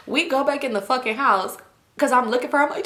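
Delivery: ordinary talk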